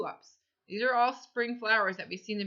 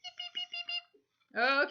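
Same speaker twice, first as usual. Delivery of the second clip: put-on baby chick; slower than usual. Shown as a demonstration. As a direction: peep like a tiny chick. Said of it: "Can you peep like a chick?".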